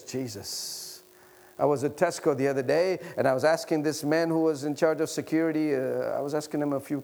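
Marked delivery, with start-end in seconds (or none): none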